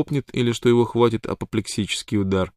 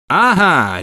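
sharp loud bark, higher; barked exclamation; 9 semitones higher, 8 dB louder than usual. A narrator's reading. Shorty catches Wild Water out, loud bark. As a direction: snap it out loud like a gotcha. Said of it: "рявкнул малыш".

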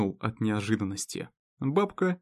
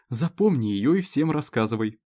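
ordinary delivery, nothing marked

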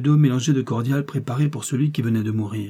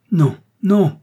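loud, high retort; emphatic refusal; 8 semitones higher, 9 dB louder than usual. A narrator's reading. Detective refusing to let the captive speak, emphatic. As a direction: refuse outright, voice raised. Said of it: "répliqua le détective".

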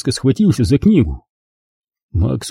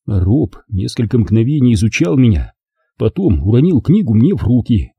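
ordinary delivery

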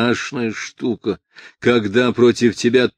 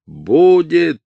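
loud, raised, stubborn insistence; 7 semitones higher, 8 dB louder than usual. A narrator's reading. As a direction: dig your heels in, loudly. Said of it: "упрямо сказал Росшапер".

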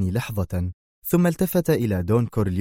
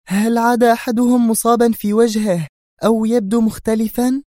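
high, louder woman's voice, eager; agitated Sonia; 13 semitones higher, 5 dB louder than usual. A narrator's reading. Sonia quizzes the Don about his injured man, agitated. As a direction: fire the question, worked up. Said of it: "تسأله في انفعال".

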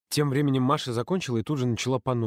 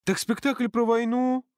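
high, slow disappointed whine; disappointed drawl; 12 semitones higher than usual. Acted. Ксюша disappointed by the war play, high drawn-out whine. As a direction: drag it out, let down. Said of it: "разочарованно протянула Ксюша".

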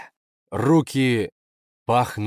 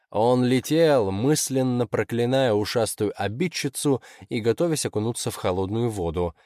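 plain reading voice

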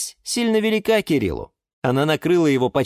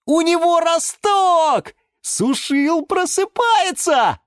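high, slow and thrilled; overjoyed; 14 semitones higher, 3 dB louder, slower than usual. A narrator's reading. Yura tells the friends the sprout is waking, overjoyed. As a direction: announce the news with delight. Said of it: "ужасно обрадовался".

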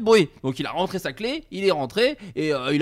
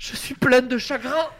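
mock grief; 8 semitones higher, slower than usual. Band member mourns Bill.

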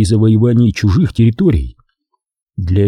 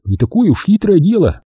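eager confidence; 9 semitones higher than usual. A narrator's reading. Genka urges his bright idea.

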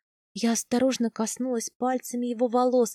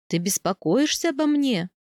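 ordinary delivery

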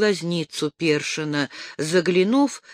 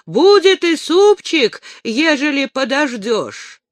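much louder and higher, slowed; shouting out; 9 semitones higher, 6 dB louder than usual. A narrator's reading. The ataman shouts his promise of soup to Dasha, loud. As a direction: bellow the promise across the clearing. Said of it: "Громко же выкрикнул".

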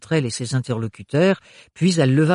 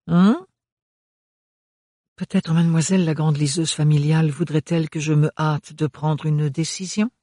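honeyed voice; 4 semitones higher, 3 dB louder than usual. Acted. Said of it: "d'une voix mielleuse".

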